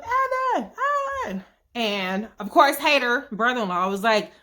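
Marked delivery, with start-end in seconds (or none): 0.0-1.5 high calling out of a name. Retold or imitated. Girlfriend yells up to Evan on the patio, high calling out. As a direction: call up to him from below. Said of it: "Jenny is yelling up".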